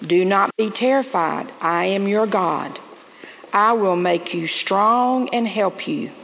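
ordinary delivery

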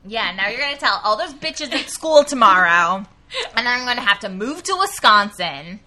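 put-on dramatic teen girl. Mock teen girl quarrel.

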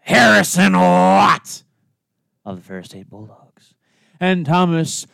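drawn out ring announcer; ringmaster. Acted. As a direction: stretch the names like a PA announcer. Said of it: "that was pretty good there".

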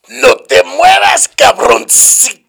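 put-on loud, slow Spanish with a Mexican accent; hammed-up gangster line in a Mexican accent; Scarface quote. Mexican-accent gangster line quoted from Scarface, loud. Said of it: "those of you have seen Scarface".

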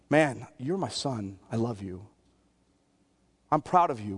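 hushed, low father's reassurance to his son; acted soft, low fatherly murmur; fatherly whispering. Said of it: "why am I whispering into".

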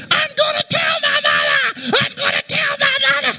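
very high-pitched hammed-up shriek; screaming kid. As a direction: squeal it like a paddled child. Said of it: "he started screaming".

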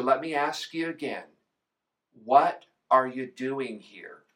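ordinary talk, nothing marked

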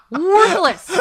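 loud, high, laughing echo; real laughter bursting through the word. Real, not acted. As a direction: crow the word while cracking up. Echoing the verdict while cracking up.

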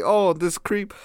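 high, put-on voice mimicking the dentist's promise; sarcastic high-pitched dentist impression; mocking the dentist. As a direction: send up the dentist in a squeaky voice. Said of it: "They say".

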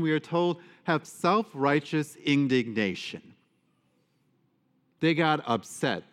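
usual speaking voice

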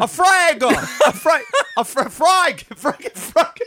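high Aussie-Cockney yelps, breaking into laughter; muddled Australian-Cockney accent. Shown as a demonstration. Voice actor fumbles the frag callout, laughing Australian-Cockney accent. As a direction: keep retrying it in bad Australian-Cockney, half laughing. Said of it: "I want you to use that accent".

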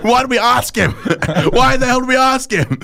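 high mock dismay through laughter; regretful group. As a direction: laugh through a retold "what have we done". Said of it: "twenty-five of us saying".

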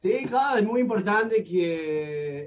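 Spanish with an American accent; English speaker's Spanish. Real, not acted. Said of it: "Ahí la lleva con el español".

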